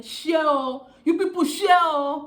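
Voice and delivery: high, loud shout; loud call-out. Real, not acted. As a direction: belt out share to the viewers. Calling out to viewers.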